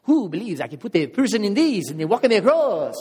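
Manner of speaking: put-on loud, high showman with a French accent. French tightrope walker challenging his crowd, loud French-accented call. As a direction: shout it out in a mock French accent. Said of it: "Actually, he's French".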